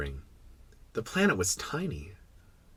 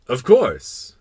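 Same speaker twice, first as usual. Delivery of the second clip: loud royal certainty; assured king; 3 semitones higher, 8 dB louder than usual. Acted. King confirming the stars obey, assured. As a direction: answer firmly as an absolute ruler. King affirms his power.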